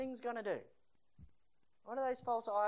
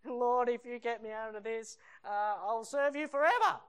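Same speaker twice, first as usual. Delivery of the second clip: loud bargaining cry, rising; drowning man's plea; 4 semitones higher, 7 dB louder than usual. Acted. Drowning sailor bargaining with the Lord, desperate cry. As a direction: mock-desperate prayer that climbs higher. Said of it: "when they're drowning at sea".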